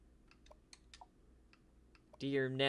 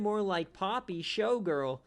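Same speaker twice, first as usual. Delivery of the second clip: ordinary talk, nothing marked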